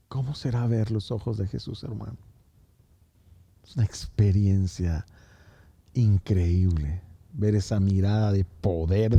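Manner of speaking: hushed altar-call tenderness. Acted. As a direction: speak gently to each person by name. Intimate quiet altar-call preaching.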